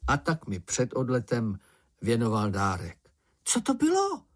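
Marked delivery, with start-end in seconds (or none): 3.4-4.2 very high, curious exclamation; little character crying out; Čenich's excited cry. A narrator's reading. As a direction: burst out with the question, pitched way up. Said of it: "vykřikl Čenich".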